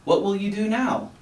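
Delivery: high curious ask; eager question. A narrator's reading. Frog asks the captain eagerly, high eager question. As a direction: lean in, eager.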